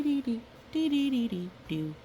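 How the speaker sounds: ordinary delivery, nothing marked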